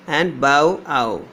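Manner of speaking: usual speaking voice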